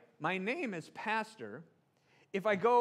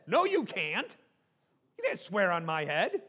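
mock indignant; 2 semitones higher, 7 dB louder than usual. Pastor forbids swearing on his head, mock indignation. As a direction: object loudly as if affronted. Pastor protests being sworn on.

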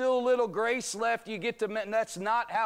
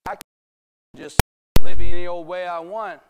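mock careless boast, suddenly much louder and faster; careless sinner; 2 semitones lower, 19 dB louder than usual. Careless believer flaunting grace to the congregation, loud mocking boast. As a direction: sneer it like someone abusing grace. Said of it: "dangerous to preach like that".

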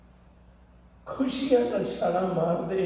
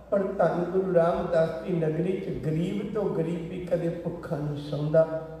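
ordinary delivery

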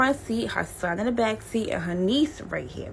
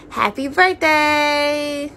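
high, drawn-out singsong greeting; mock birthday cheer; 7 semitones higher, 4 dB louder, much slower than usual. Mother pushing the bike forward, slow high singsong. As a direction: stretch it out in imitated cheer.

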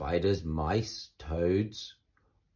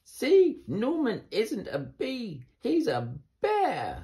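much higher, louder on See, then slow on bear; triumphant Amelia; 14 semitones higher, 4 dB louder, slower than usual. Acted. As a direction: announce the proof in triumph. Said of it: "said Amelia".

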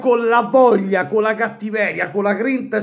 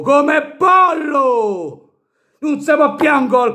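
drawn-out angry yell; explosive italian cursing; 6 semitones higher, 3 dB louder, slower than usual. Real, not acted. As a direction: stretch it out in disgust. Fan rants at goalkeeper.

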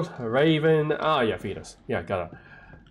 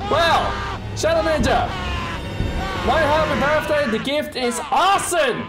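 excited shout; 11 semitones higher than usual. Real, not acted. Excited thanks after chaos.

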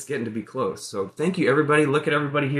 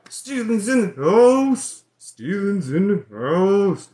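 hype announcement; 6 semitones higher, 8 dB louder, slower than usual. Real, not acted. Announcing a viewer's arrival.

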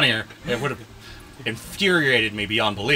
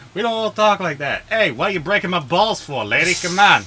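higher, indignant New York comeback; mock New York accent; 4 semitones higher than usual. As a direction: argue back like a put-upon New Yorker. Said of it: "Oh, I would've kept going".

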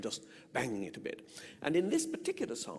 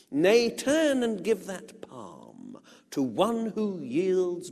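slow, expressive poetry reading; measured verse reading; poem recital; 6 dB louder, slower than usual. Acted. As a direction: declaim the verse slowly with feeling. Recites the song lyric.

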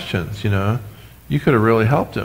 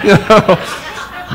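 laughing; 8 semitones higher than usual. Real, not acted.

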